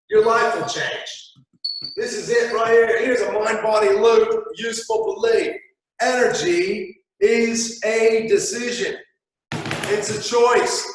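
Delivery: emphatic conviction. Real, not acted. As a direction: land the big idea slowly. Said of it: "motivational emphasis".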